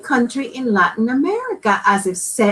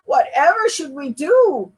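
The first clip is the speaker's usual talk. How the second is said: mild sniffle; 6 semitones higher than usual. Acted. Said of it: "sniffled Papa".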